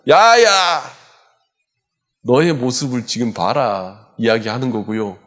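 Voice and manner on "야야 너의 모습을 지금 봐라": sneering devil, sharp start then slowing; devil's taunt. Retold or imitated. Devil mocking a sinner's dirty state, sneering. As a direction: jeer at them, then drag it out.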